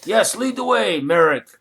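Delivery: calling out. Acted. Urging Merrick to go first, loud hearty call. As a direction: send it out loudly to the one in front. Player calls to Merrick in character.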